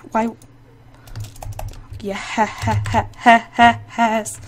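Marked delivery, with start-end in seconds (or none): none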